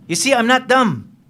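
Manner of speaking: high, pointed insistence; defensive boast. Acted. Lieutenant insisting to the American he is not dumb, high. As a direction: prove you're no fool.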